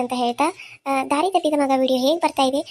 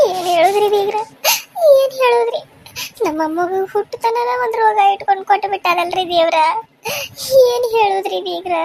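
tearful, raised lament; acted wailing; 7 semitones higher, 5 dB louder than usual. Grandparent breaking grave news to in-law, wailing. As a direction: wail it out to the in-law. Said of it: "ಯಾಕೆ ಅಳಾತಾರ ಅಳಿಯಂದ್ರ?".

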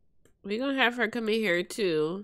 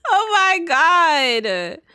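drawn-out stunned oh my God; slow shocked oh my God; slow disbelief; 5 semitones higher, 6 dB louder, much slower than usual. Real, not acted. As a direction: stretch it slowly in disbelief. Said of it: "Started rehab".

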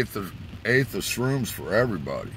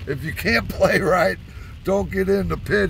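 higher, louder lecturing voice; Bobby scolding; 11 semitones higher, 3 dB louder than usual. Retold or imitated. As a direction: lay down the mosh pit rules. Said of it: "He goes".

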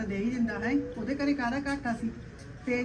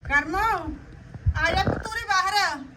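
calling out; 7 semitones higher, much slower than usual. Acted. Mother calls daughter outside.

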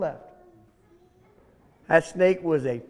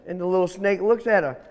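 ordinary delivery, nothing marked

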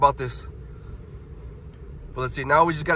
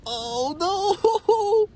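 high squealed oh no; frightened high-pitched oh no; real alarm. Real, not acted. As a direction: squeal it as the blast hits. Jets blast the truck again.

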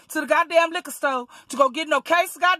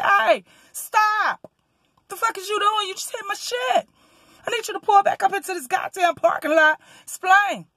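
retold yelling; 7 semitones higher than usual. Yelling after the other driver.

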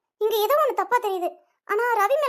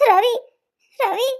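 dragging out ரவி between pauses; halting, drawn-out name; 4 dB louder, much slower than usual. Acted. Weeping girl unable to finish, dragging out the name. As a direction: falter on the name, stretching it. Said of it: "என்ன ரவி, ரவின்னு இழுக்கிற".

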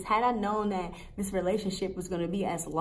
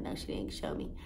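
whispered reply; 9 dB quieter than usual. Retold or imitated.

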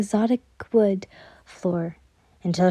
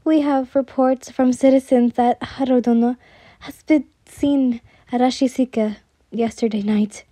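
ordinary delivery, nothing marked

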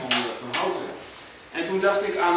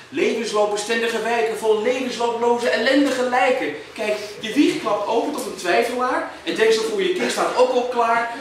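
higher, driving declamation; defiant poem recital; 7 semitones higher, 4 dB louder than usual. Acted. Poet refusing to live in lifetime-proof suburbs, defiant recital. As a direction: refuse it outright, pushing through the verse.

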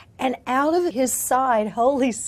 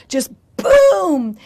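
shouted, higher boom; 6 semitones higher, 13 dB louder than usual. Retold or imitated. Preacher shouting boom as power falls. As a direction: burst out the boom like an explosion. Describing power falling in London.

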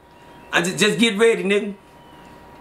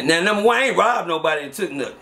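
normal talking voice

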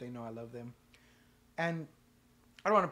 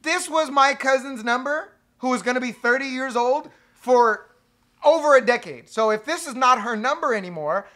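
flustered explaining; 6 semitones higher, 10 dB louder than usual. Retold or imitated. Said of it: "I was like".

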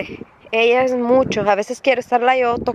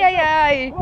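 ordinary talk, nothing marked

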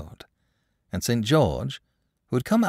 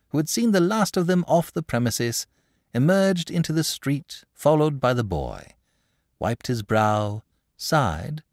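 ordinary delivery, nothing marked

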